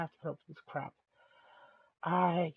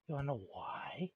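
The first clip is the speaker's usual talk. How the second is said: whisper; 2 semitones lower, 10 dB quieter than usual. Real, not acted. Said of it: "I'm using my voice in a calm manner".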